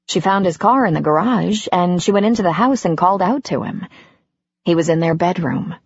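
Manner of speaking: plain reading voice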